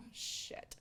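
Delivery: very soft, whispered curse; hushed swear. Acted. Storyteller voicing her dread of being seen, hushed swear. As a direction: let it slip out under the breath.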